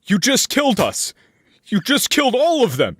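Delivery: very high acted scream of accusation. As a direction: cry out high at the doctor, horrified. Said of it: "is just going to scream out".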